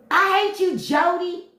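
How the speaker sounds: high-pitched yelled DC twang; Taraji P Henson. Retold or imitated. Imitating mad movie girlfriend in Baby Boy, high DC twang. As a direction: wail it in a heated DC accent. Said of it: "It's how she say hate".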